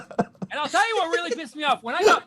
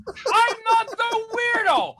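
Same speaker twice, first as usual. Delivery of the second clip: emphatic shout; 5 semitones higher, slower than usual. Acted. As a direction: insist on each word. Emphatic slow declaration.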